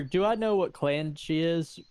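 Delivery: ordinary delivery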